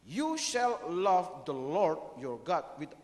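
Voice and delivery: slow, loud English reading of the verse; English quote. Shown as a demonstration. Preacher quoting the English Bible slowly and loudly. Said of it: "Bahasa Inggris bilang".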